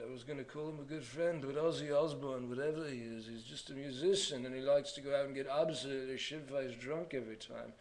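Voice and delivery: slurred, rambling British rocker impression. Vlogger impersonating a hard-drinking musician, British rocker voice. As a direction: ramble like a drunk British rock singer. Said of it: "talking about ... [name]".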